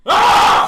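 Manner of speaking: sudden loud high scream; imitated scream. Roommate waking screaming in the night, shrieking. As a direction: belt out a mock night-terror scream. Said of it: "And he just screamed like that".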